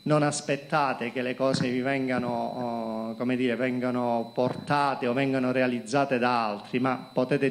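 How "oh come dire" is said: ordinary talk, nothing marked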